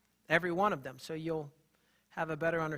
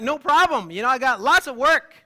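loud eager reply; 6 semitones higher, 12 dB louder than usual. Retold or imitated. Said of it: "And I'm like".

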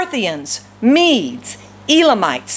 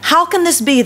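higher-pitched, mildly astonished question; amazed exclamation; 7 semitones higher than usual. A narrator's reading. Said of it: "they exclaimed".

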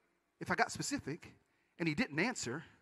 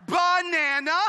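a loud, high "Banana!" as the punchline; excited shout; 7 semitones higher, 9 dB louder, much slower than usual. Acted. Preacher tells his church about the fortune cookie, excited shout.

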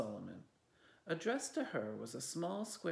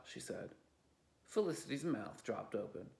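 plain reading voice

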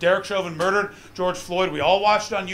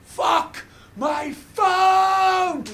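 long, slow, much higher yell of anguish; anguished scream; 13 semitones higher, much slower than usual. Retold or imitated. Comedian on cobblestones screaming after stolen phone, drawn-out anguished yell. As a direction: stretch it out like a howl of frustration. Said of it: "screamed in anguish and frustration".

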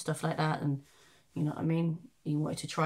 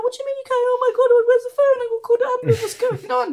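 much higher and louder, fast frantic questions; panicking mum; 12 dB louder, much faster than usual. Retold or imitated. Mother panicking over her son, high and loud. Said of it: "You're panicking and shit like that".

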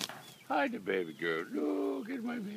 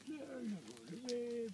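slow soothing; 2 semitones higher, 9 dB quieter, much slower than usual. Real, not acted. Soothing a peacock.

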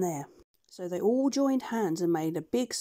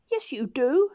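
higher, louder duck voice; scolding duck; 6 semitones higher, 6 dB louder than usual. Acted. Dab Dab scolds the doctor about money, high scolding duck. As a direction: scold like a sharp housekeeping duck. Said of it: "said Dab Dab the duck".

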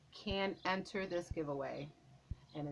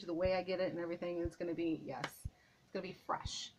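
ordinary talk, nothing marked

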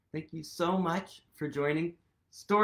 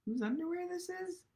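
higher, fast and soft; high playful question; 13 semitones higher, 8 dB quieter, faster than usual. Acted. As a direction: pitch it up cheekily for the kids.

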